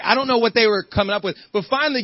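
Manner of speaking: ordinary talk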